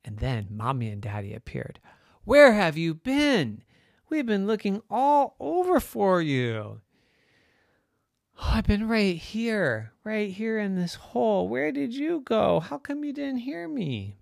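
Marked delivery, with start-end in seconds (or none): 2.2-6.8 fretful parental exclamation; worried parent. Acted. Worried parents find lost son. 8.4-14.2 high, whiny explanation; aggrieved, rising protest; protesting child. Acted. Boy protests to his parents.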